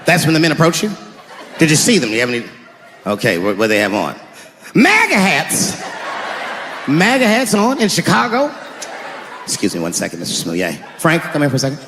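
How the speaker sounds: put-on a skeptical old cop taking a report; weary veteran cop. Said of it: "if you was a police veteran taking this kid's police report".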